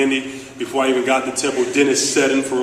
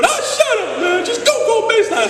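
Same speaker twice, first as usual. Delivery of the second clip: Coach Chaney's voice. Retold or imitated. Coach scolds his player for not going baseline, squeaky shouting. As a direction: yell like an exasperated old coach, voice cracking high. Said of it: "he speaks like this".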